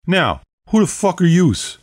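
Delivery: put-on punchy, high-pitched Brooklyn accent. A narrator's reading. Vampire leader confronts her heckler, in a Brooklyn accent. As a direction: throw it back in thick Brooklynese. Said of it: "said in a perfect Brooklyn accent".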